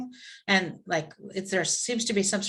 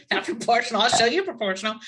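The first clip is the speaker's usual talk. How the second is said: lightly acted angry retort, pitched up; mock indignant; 3 semitones higher, 4 dB louder, faster than usual. Angry man answering the person calming him, mock indignant. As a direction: throw it back in mock outrage. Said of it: "extremely angry naked man".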